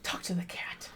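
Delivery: ordinary talk, nothing marked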